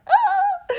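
very high, loud excited squeal. Real, not acted. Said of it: "I can feel it in my body".

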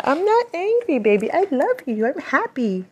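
put-on high squeaky baby voice; squeaky pet voice. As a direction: play a tiny cute creature.

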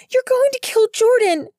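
loud, very high desperate protest; high, urgent yell to stop someone; alarmed shout. Acted. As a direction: shout a frantic warning. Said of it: "Nix shouted at Belly".

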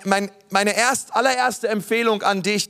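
ordinary talk

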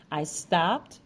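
ordinary delivery

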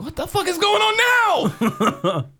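high, drawn-out comic wail; hammed-up exasperated panic, voice shooting up; mock panicked outburst. Retold panic at the returning sound.

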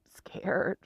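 breathy whisper of scared; a real, frightened whisper; whispered fear. Real, not acted. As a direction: let it slip out as a whisper. Admits fear, repeats word.

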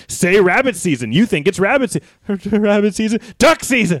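high, loud yelling that keeps climbing; escalating cartoon argument. Gun-wielding bunny and victim arguing over the season, yelling. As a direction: build to a frantic high shout.

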